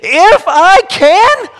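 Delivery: high, loud, slow disbelief; incredulous. Acted. Jesus echoes the doubt incredulously.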